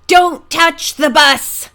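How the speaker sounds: loud, slow warning cry. A narrator's reading. Driver warning the others as Ned leads him away, loud and slow. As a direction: warn them off the bus, drawn out. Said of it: "the driver cried".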